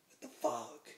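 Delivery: mock startled reply; retold startled echo. Teller echoes the bouncer's words.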